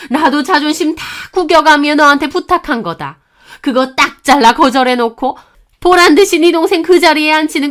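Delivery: high, loud mother-in-law rant; furious screeching tirade. Acted. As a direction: berate her with one indignant accusation after another. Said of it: "언성을 더욱 높이며 악을 썼습니다". (언성 높여 악쓰는 시어머니)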